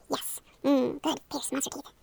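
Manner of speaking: ordinary talk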